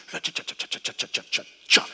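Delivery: breathy whispered cha cha of a slowing roulette ball; soft whispered clicks as the wheel winds down; whispered ball ticks. Pretend. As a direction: tick the ball down to a stop under your breath.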